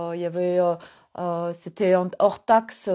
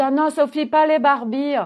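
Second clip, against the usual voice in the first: mild mock protest, pitched higher; mock objection; 8 semitones higher, 5 dB louder than usual. Someone objects to Sophie's Barbies, mock protest. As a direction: lightly imitate someone objecting, higher and louder. Acted objection from her critics.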